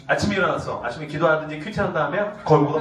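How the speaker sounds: usual speaking voice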